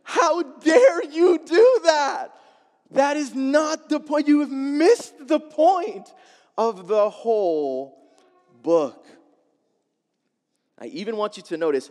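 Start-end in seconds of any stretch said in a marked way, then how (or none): 0.0-9.1 loud, higher, slow hammed-up scolding; play-scold the cartoon like it insulted you; mock outrage at video. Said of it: "And I said".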